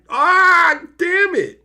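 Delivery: high, loud ah damn it; frustrated outburst. Retold or imitated. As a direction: blurt out the frustration at missing him. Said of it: "It's like".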